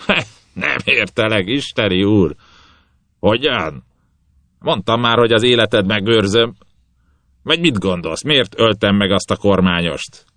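captain taunting the bound god; mocking captain. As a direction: toy with him, loud and sure. Captain answers prisoner.

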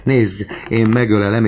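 high, loud fervent urging; crying out. A narrator's reading. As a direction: urge her at the top of your feeling. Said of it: "kiáltotta Gorió".

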